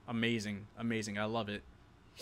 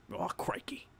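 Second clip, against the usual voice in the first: higher, softer hammed-up Aussie exclamation; mock Australian accent; 7 semitones higher, 7 dB quieter than usual. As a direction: put on a joking Australian accent. Playing up his Australian accent.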